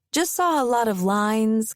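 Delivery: plain reading voice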